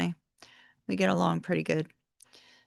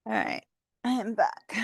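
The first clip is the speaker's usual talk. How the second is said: hushed return whisper. Real, not acted. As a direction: whisper it back in. Returns to the stream quietly.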